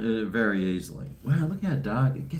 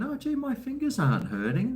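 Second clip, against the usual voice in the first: high mock voice; 13 semitones higher than usual. Mock surprised inner thought.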